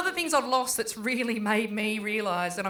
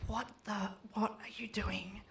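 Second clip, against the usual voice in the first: softer, slow embarrassed whisper; mortified whisper; 12 dB quieter than usual. Retold or imitated. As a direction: hiss it in horror at your mum. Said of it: "she's like".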